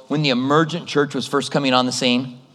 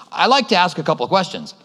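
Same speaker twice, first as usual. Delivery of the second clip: ordinary talk, nothing marked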